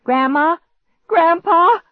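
high, loud frantic call for help; crying out. A narrator's reading. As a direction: call desperately through the house. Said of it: "I cried out".

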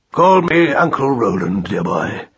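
lightly voiced kindly uncle; falling, genial uncle's voice; avuncular rabbit. A narrator's reading.

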